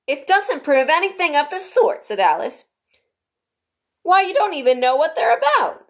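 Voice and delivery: high, loud protest; indignant Alice. A narrator's reading. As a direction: object like a cross girl.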